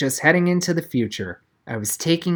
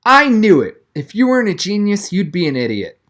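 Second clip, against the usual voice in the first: loud, high, exasperated outburst; blurting out in frustration; angry outburst; 5 semitones higher, 4 dB louder than usual. A narrator's reading. Donovan angry at Noah's cheating.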